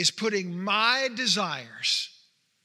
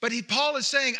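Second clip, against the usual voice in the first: ordinary delivery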